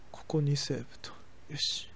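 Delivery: soft unvoiced whisper to himself. Real, not acted. Streamer murmuring to himself while saving, whispered. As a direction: breathe the words as you save.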